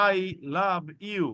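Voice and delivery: I love you dragged out word by word; very slow wrong reading. Shown as a demonstration. Teacher mocking a slow reading, very slow. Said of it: "আপনি এটাও বলবেন না".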